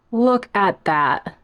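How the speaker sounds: "Look at that" drawn out slow; slow admiring. Shown as a demonstration. Showing off the plant to viewers, slow and savouring. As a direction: savour each word.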